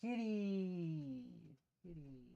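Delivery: high, soft kitty call; cooing to the cat. Real, not acted. Streamer coos a greeting to his cat, high and soft. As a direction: coo sweetly at the cat.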